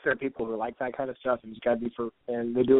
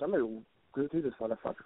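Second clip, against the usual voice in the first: ordinary talk, nothing marked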